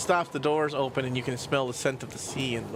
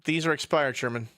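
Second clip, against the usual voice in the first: usual speaking voice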